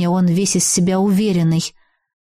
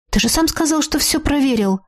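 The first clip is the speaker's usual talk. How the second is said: indignant surprise; 6 semitones higher than usual. Acted. Indignant reproach to Herbert.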